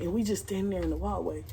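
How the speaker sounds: ordinary delivery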